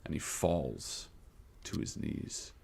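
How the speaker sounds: slow narration sinking to a hush; game master scene setting. Acted. Game master describing the captain's beheading, hushed fading narration.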